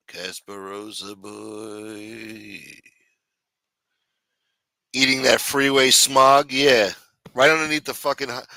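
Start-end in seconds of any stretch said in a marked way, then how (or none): 0.1-2.6 murmured name echo. Acted.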